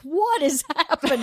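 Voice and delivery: bewildered question broken by a laugh; laughing disbelief. Retold or imitated. Newcomer stepping off the bus, laughing disbelief. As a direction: laugh through it, stunned. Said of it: "And I was like".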